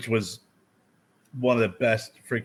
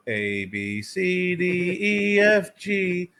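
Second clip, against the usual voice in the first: slow letters jumping up high; smart-ass recital; 7 semitones higher, much slower than usual. Retold or imitated. Driver reciting ABCs for a cop, smart-ass and high. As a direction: recite the alphabet like a wise guy, pitched up. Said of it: "Don't be a smart ass".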